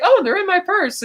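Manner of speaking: high, bright-sounding mock chirp, as if it's good news; cheerfully clueless. Goodnight cheerfully telling Bond where the keys are. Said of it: "she says it as if that's a good thing".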